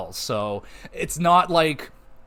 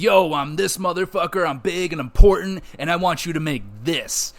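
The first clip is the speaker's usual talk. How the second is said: a hint of a swaggering big shot, a bit louder and higher; cocky VIP customer; 5 semitones higher, 4 dB louder than usual. VIP customer ordering a special meal, mock swagger. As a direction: lightly play the self-important VIP bragging. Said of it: "They're gonna be like".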